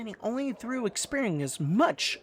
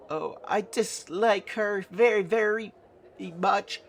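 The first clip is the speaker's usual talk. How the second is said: higher, sweet attempt at meanness; badly faked dislike; 5 semitones higher than usual. Nanny practices saying something bad about a woman, higher, mock-stern. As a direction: try unconvincingly to sound disapproving. Character tries acting mean, unconvincingly.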